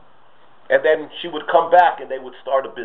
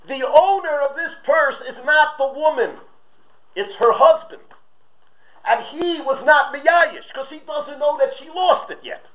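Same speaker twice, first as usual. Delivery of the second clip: stern rabbi ruling; 10 semitones higher than usual. Acted. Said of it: "So Rabbi Yitzchak Elchanan says".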